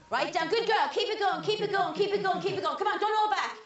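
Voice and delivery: high, insistent chant of encouragement; urgent encouragement. Real, not acted. Midwife as baby is almost out, urgent encouragement.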